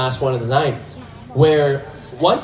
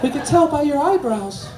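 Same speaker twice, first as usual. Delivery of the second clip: put-on very high, soft child voice; small high voice seeking attention; little boy; 10 semitones higher, 7 dB quieter than usual. Little boys tugging at his shirt, soft high child voice.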